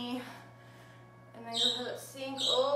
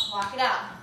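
ordinary talk, nothing marked

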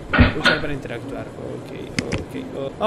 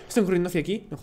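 hushed low; 4 semitones lower, 9 dB quieter, faster than usual. Real, not acted. Mannequins approaching, repeated lower.

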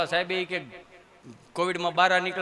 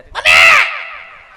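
a drawn-out, very high, very loud "mummy!"; stretched piercing child cry for mom; child's wail; 17 dB louder, much slower than usual. Retold or imitated. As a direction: wail like a toddler who just fell. Fallen child cries for mother.

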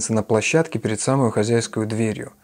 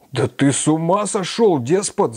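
much higher, slow furious bellow; roaring; 6 semitones higher, slower than usual. A narrator's reading. Razumikhin bellowing at his stubborn friend, roaring. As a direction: roar at the tyrant. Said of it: "заревел Разумихин".

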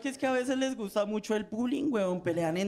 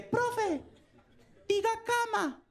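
put-on child's voice; 13 semitones higher, slower than usual. Niño le tiende una trampa.

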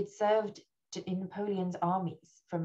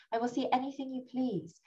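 raised-pitch insistence, not shouted; crying out; 7 semitones higher than usual. A narrator's reading. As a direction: narrator acts a mild, urgent promise. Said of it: "cried the Chevalier".

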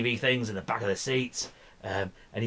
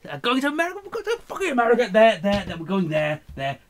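much higher, fast, loud; excited; 10 semitones higher, 6 dB louder than usual. Real, not acted. Hyping the trip to America, much higher and loud. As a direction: get giddy about the trip.

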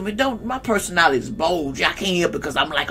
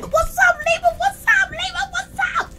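very high squeaky calling out; high sing-song greeting. Pretend. Calling out to Libra fans in a squeaky high voice.

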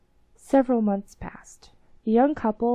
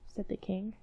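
usual speaking voice

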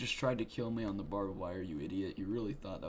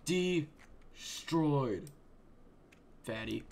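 raging gamer; 5 semitones higher, 11 dB louder, much slower than usual. Real, not acted. Gamer triumph after kill.

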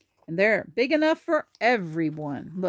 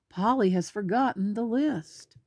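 ordinary delivery

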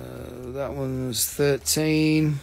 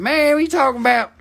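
put-on US accent; 4 semitones higher than usual. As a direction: put on an argumentative big-city American accent. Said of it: "Does the U S accent throw everyone else off?".

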